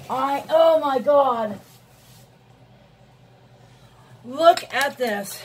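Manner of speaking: loud, slow, horrified exclamation; real shock. Real, not acted. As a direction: drag it out in loud disbelief. Shocked at the broken palette.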